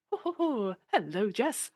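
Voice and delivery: high, jolly postman greeting; heartwarming postman. Shown as a demonstration. Improviser demoing a clingy-priest style postman, jolly high greeting. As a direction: beam out a cheery postman hello. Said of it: "heartwarming postman".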